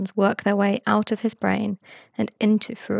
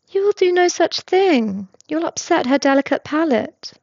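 higher, louder mock-scandalized protest; mock outrage; 8 semitones higher, 4 dB louder than usual. A narrator's reading. James teasing Regulus about feeding the cat, mock-outraged and higher. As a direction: feign shock for the cat's sake. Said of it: "James gasps".

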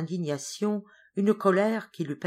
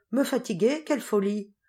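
higher, lively exclamation; surprised protest; 5 semitones higher, 3 dB louder than usual. A narrator's reading. Geneviève dismisses Jacques's worry about tiring her, surprised protest. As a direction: brush the worry off with mock astonishment.

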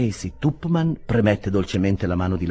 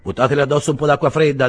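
brisk order; 7 semitones higher, 6 dB louder, faster than usual. A narrator's reading. Ordering help for fainting aunt.

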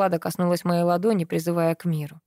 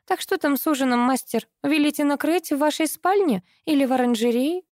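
lightly voiced, higher, bored servant; phlegmatic female voice; 8 semitones higher than usual. A narrator's reading. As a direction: ask flatly, higher pitched. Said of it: "флегматично ответила она".

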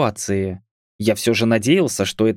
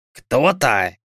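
put-on very old man. A narrator's reading. Old man's short question.

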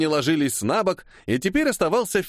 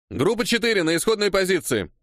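high, raised announcing voice; megaphone command; 7 semitones higher than usual. A narrator's reading. Assistant director calling the crew into position, commanding. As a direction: call it out as if through a megaphone. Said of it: "прогремел усиленным мегафоном голос помощника режиссера".

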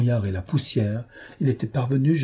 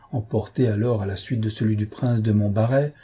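usual speaking voice

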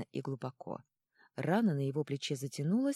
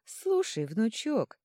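put-on high, loud old-woman call; 7 semitones higher, 5 dB louder, much slower than usual. A narrator's reading. Old woman to the leader, wheedling high old voice. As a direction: call the grandson over, pitched high.

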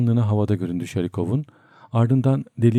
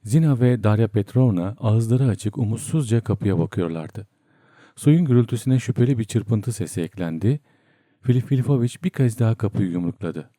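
usual speaking voice

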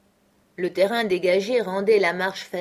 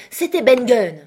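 loud, raised cry of realisation; exclaiming; 5 semitones higher, 10 dB louder than usual. A narrator's reading. Morgan naming the mystery voice, loud exclamation. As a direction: blurt the name out loudly. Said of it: "s'écria Morgan".

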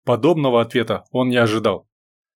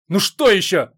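barking; 6 semitones higher than usual. A narrator's reading. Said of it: "рявкнула девушка".

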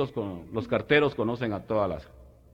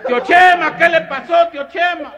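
very high, loud worried call; alarmed yell; 11 dB louder, slower than usual. Retold or imitated. Macabeo running to the hit old man, calling very high and loud.

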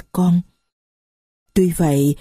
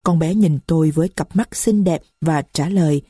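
ordinary delivery

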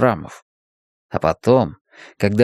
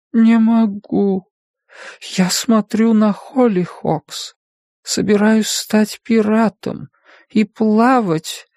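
slow, higher, drifting off; dreamy, fading voice; 10 semitones higher, slower than usual. A narrator's reading. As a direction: let it fade as if falling asleep. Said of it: "Голос становился всё тише".

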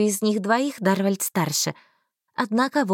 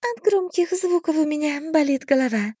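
fairy voice; 7 semitones higher than usual. A narrator's reading. Fairy complains.

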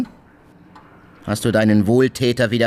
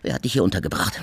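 normal talking voice

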